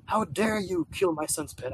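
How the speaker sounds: lightly voiced huffy father; indignant dad. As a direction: drag it out like an offended father. Dad scolds the player.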